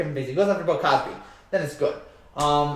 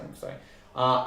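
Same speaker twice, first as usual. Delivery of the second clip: usual speaking voice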